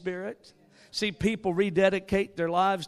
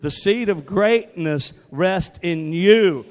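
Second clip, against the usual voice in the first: louder, slow, emphatic declaration; said like you mean it; 11 dB louder, much slower than usual. Shown as a demonstration. Telling a neighbor, eye to eye, a loud slow proclamation. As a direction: proclaim it with conviction, slowly. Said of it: "Say it like you really mean it".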